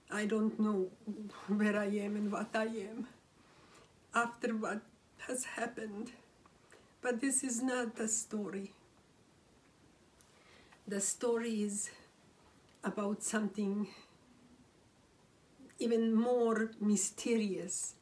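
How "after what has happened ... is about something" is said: ordinary delivery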